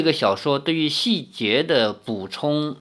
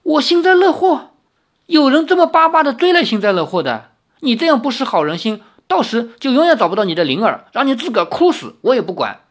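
high-pitched, loud, outraged tirade; indignant fury; 9 semitones higher, 4 dB louder, faster than usual. Acted. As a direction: protest as if too angry to speak. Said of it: "气得几乎说不出话来".

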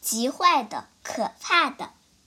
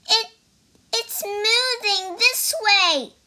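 higher stammering creature voice; frightened squirrel; 9 semitones higher, 4 dB louder than usual. Acted. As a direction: stutter it out in alarm. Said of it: "cried a squirrel".

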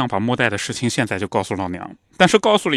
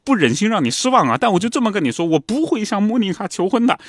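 pleading son; 9 semitones higher than usual. Retold or imitated. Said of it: "沃尔特他说".

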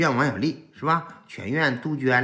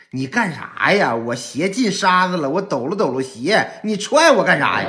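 indignant mock complaint, rising to a yell; retold angry outburst; 4 semitones higher, 5 dB louder than usual. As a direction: imitate an exasperated director protesting, louder at the end. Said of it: "当时导演都跟我急眼了". (模仿急眼了的抱怨)

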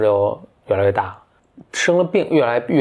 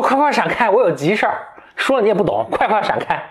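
higher, curt brush-off; impatient prince; 9 semitones higher than usual. Acted. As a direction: shoo him out of the way. (不耐烦地让侏儒闪开)

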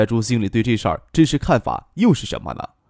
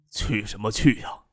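slow, low, hushed reply; soft sighing; 3 semitones lower, 6 dB quieter than usual. A narrator's reading. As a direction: sigh the question out wearily and softly. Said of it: "徐学平轻声叹道".

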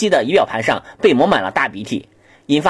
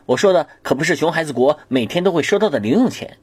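ordinary talk, nothing marked

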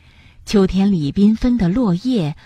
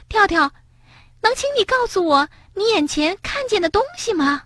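much higher, gentle mole voice; little mole; 12 semitones higher than usual. Acted. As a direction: play the small mole asking kindly. Mole character voice.

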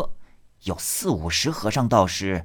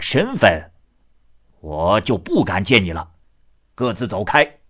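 loud blunt ruffian; 4 dB louder than usual. Acted. Voices Ni Er offering silver.

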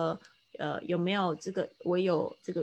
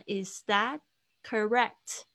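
"is that correct" slowly, lifting at the end; questioning rise; 2 semitones higher, slower than usual. Shown as a demonstration. Teacher shows students the question "is that correct", rising intonation. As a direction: split "is that ... correct" with a questioning upturn. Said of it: "所以它上扬的声音".

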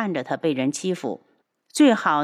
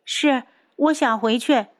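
put-on petulant young girl; 6 semitones higher than usual. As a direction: whine like a spoiled young girl. Liu'er's voice.

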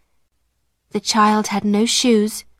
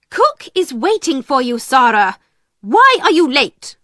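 a higher, louder stern headmistress demanding answers; stern scolding; 4 semitones higher, 4 dB louder than usual. Acted. Headmistress scolding the servant girl for coming late, sharp and stern. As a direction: snap at her for being late, rising to the question.

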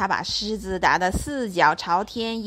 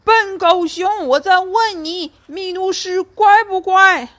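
roaring; 9 semitones higher, 8 dB louder than usual. Acted. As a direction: bellow at the deaf bear. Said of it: "狮子吼道".

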